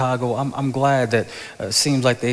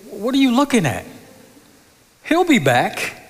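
angel's call; 12 semitones higher, 4 dB louder than usual. Retold or imitated. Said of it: "remember what the angel said".

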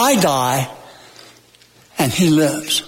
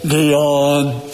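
a lightly voiced God calling one name, lower and drawn out; 5 semitones lower than usual. Preacher imagines God calling a listener by name, low drawn-out call. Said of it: "waiting for God to say".

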